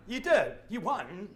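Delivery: usual speaking voice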